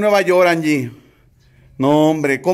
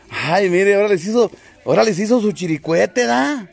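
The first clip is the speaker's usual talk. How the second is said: ordinary delivery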